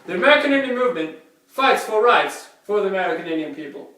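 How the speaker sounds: fast, clipped school presenter; rushed report reading. Acted. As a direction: rattle it off like a class report.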